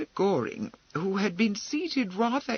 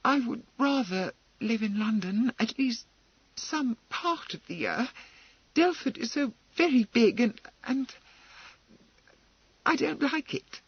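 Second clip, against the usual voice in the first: high, faltering plea; pleading; 8 semitones higher than usual. Acted. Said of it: "She flushed and added pleadingly".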